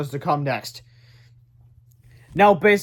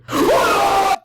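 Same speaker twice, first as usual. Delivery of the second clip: gasp; 8 dB louder than usual. A narrator's reading. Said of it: "George screamed".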